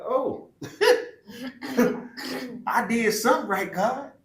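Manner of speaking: overjoyed, thanking God; real delighted laughter; joyful laughing. Real, not acted. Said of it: "I was like".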